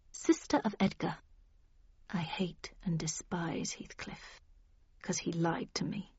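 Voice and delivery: very soft, near-whispered grievance; hushed, wounded admission; hushed confession. A narrator's reading. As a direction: confide it barely above a whisper. Isabella recounts her mistreatment.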